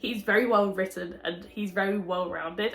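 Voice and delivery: ordinary talk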